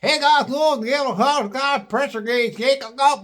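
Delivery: garbled hillbilly talk. Retold or imitated. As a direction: mush the words except the gauge. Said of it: "heard pressure gauge".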